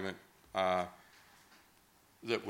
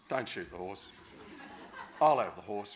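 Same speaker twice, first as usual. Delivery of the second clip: laughing plea. Retold or imitated. Pleading for the horse, laughing.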